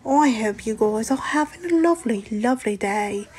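put-on muddled accent, a little Australian, a little British. Streamer showing viewers the only accent they can do, mock Australian-British accent. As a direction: lilt it in a playful, uncertain Aussie-British mix. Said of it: "a little Australian, a little British".

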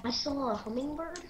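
ordinary delivery

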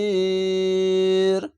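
"মাসির" with a very long level ee; three-alif stretch. Shown as a demonstration. Teacher recites a verse ending for learners, hugely stretched. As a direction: hold the সি far out before the final র. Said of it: "তিন আলিফ টেনে পড়বেন".

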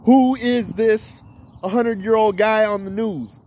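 slow, deliberate question; slow clear-the-message demo; slow demo delivery. Shown as a demonstration. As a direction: spell it out slowly to be heard. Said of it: "then you go like this".

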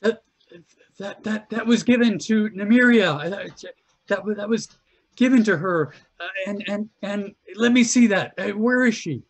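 stammering high panicked demand; tiefling woman demanding her daughter's pendant, frantic; high, loud, frantic accusation. Acted. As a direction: push it high and loud, desperate.

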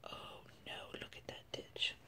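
breathy whisper, slowed down; hushed scared chicken whisper. Acted. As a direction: whisper it like you're afraid of being heard. Fearful peek at the ditch.

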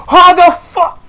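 high, loud disbelieving yell; sudden shout of shock. Real, not acted. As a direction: blurt out in disbelief.

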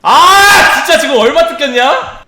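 loud high angry outburst; frustrated shout. Real, not acted. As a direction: yell it in frustration. Frustrated at losing money. (짜증 나서 외치는 소리)

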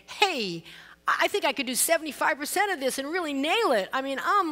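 swaggering confident guy; brash bragging man's voice; cocky. Retold or imitated. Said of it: "most men would say".

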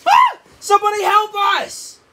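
high, loud scream for help; panicked scream. Acted. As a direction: shriek for rescue in terror.

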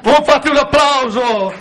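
ordinary talk

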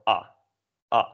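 short "ah" for Dutch A; second, shorter Dutch A sound. Shown as a demonstration. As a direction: say the A as a brief Dutch vowel. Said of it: "We'll go into more detail about that difference".

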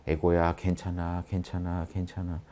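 lulling the ego to calm; soothing the ego. Acted. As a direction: hush it like a fussing child. Talking the ego down, acted.

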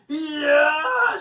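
high, loud drawn-out scream; pained scream. A narrator's reading. Paul grabs the other pigtail, Leslie screams. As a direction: scream from a sharp tug. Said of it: "she screamed".

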